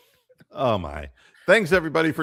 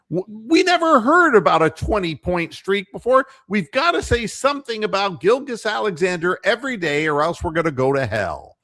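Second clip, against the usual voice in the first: sarcastic rant; 8 semitones higher, 4 dB louder than usual. Real, not acted. Sarcastic rant about coverage.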